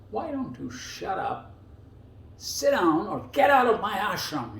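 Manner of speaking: a touch of a stern guru throwing someone out; stern rebuke. Retold or imitated. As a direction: order the man out like Billy Jack. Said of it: "just like Billy Jack".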